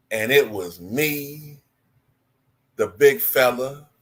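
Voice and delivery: slow, measured storytelling cadence; Magic Johnson voice. Shown as a demonstration. Impressionist doing Magic Johnson, slow and drawn out. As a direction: drawl it out slowly, like Magic telling a story. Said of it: "Magic kinda talk like this".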